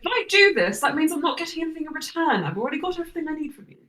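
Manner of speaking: high, quick protest; high-voiced protesting [name]. [name] objects to doing favors for nothing, high and quick.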